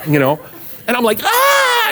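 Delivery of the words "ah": high-pitched scream of pain; mock scream. Boy as mom just about castrates him, mock screaming. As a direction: shriek like a kid being grabbed, played for laughs. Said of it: "you know, screaming".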